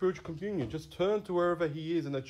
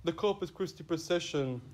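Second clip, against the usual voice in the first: normal talking voice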